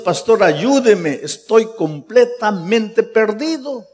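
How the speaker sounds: the boy's desperate plea, hammed up high and loud; crying plea. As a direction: cry out for help, drawn out. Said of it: "llorando y diciendo".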